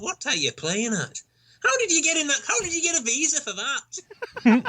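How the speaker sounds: high-pitched incredulous challenge; exasperated, rising comic disbelief; mock incredulity. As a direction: demand to know, voice climbing way up. Mock challenge to hipsters coming over.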